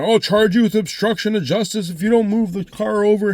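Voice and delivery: officer threatening the driver who won't move, angry; angry cop. Retold or imitated. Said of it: "he got fucking angry".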